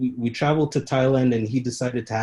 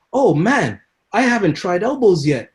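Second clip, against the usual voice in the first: higher and louder, eager fighter; 6 semitones higher, 6 dB louder than usual. Retold or imitated. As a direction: sound keen to try something new. Fighter eager between rounds.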